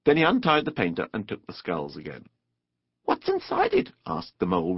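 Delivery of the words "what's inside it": very high, eager little question; wriggling curiosity. A narrator's reading. Mole asks about the luncheon basket, curious. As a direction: squeak it, bursting to know. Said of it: "wriggling with curiosity".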